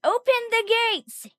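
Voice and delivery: high-pitched yelled command; mock yell. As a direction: call it out high, like an urgent order. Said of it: "Lars yells".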